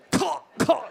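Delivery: high crow-like choking caw. Retold or imitated. Comic choking on his own saliva in a crowded room. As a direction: choke out a bird squawk.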